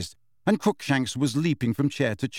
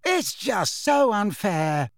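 high, slow complaint; numb dismay; 9 semitones higher, slower than usual. A narrator's reading. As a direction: draw out the unfairness, pitched up. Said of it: "said Alicia numbly".

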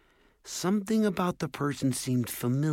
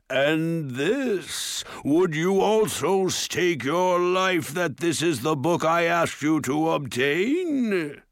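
slow, louder, looming ogre voice; menacing ogre; 7 semitones higher, 3 dB louder, slower than usual. A narrator's reading. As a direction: loom over them, cold and slow. Ogre confronts the dwarf.

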